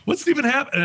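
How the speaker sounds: high-pitched exasperated outburst; retold frustration. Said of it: "I'm frustrated".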